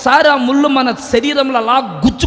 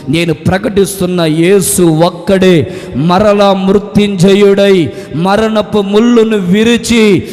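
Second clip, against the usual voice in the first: builds to louder and louder cries of Yesu; full-force preaching; 3 semitones lower, 5 dB louder, slower than usual. Real, not acted. The preacher proclaims the risen Jesus, building to loud shouts. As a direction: preach it to a rising climax. Climactic preaching over music.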